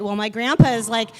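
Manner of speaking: ordinary talk, nothing marked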